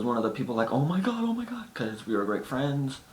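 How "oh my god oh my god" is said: high-pitched panicked gushing; friend freaking out. Retold or imitated. A friend freaking out seeing her faint, high panicked gushing. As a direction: squeal it like a worried friend. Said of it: "She was freaking out".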